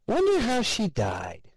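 high, nosy coworker voice; nasal gossip voice. A narrator's reading. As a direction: pitch it up like a gossiping slob. Ted voiced, far higher.

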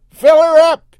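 very loud, high yell. Acted. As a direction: bellow it with glee. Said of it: "he yelled".

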